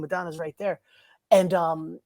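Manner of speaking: ordinary talk, nothing marked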